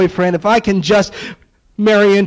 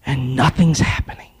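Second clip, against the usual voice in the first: hushed whisper, voice dropped away; 6 semitones lower, 4 dB quieter than usual. Acted. Preacher building suspense over the silent altar, hushed whisper. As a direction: let the line die to a breath. Dusk falls and nothing happens.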